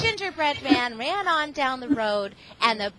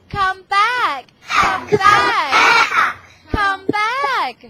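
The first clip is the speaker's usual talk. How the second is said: high loud calling; chasing crowd yelling come back; 7 semitones higher, 6 dB louder, much slower than usual. Acted. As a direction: shout after him again and again. Chasers yelling.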